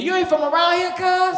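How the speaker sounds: high, loud Crip challenge; suspicious gangster. Retold or imitated. Crip asks the comic if he's from around here, loud. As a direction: size up the outsider loudly.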